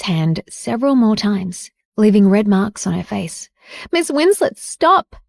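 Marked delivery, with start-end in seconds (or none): 3.9-5.2 shouting. A narrator's reading. Evelyn stages a fight, shouting at Jade to stop. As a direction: cry out as if being attacked. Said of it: "Evelyn shouted".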